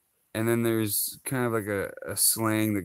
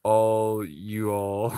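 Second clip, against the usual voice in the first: all you all drawn out slowly with rising all; 3 dB louder, much slower than usual. Shown as a demonstration. Showing viewers the wrong version, slow.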